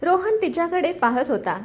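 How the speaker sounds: plain reading voice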